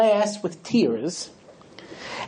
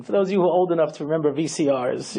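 ordinary talk, nothing marked

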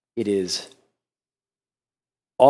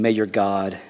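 hushed sorrowful king; 8 semitones lower, 7 dB quieter than usual. Acted. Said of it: "The king declared to Daniel".

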